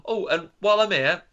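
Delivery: breezy put-on doctor afterthought; mock doctor. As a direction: play an offhand doctor tacking on news. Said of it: "the doctor's saying".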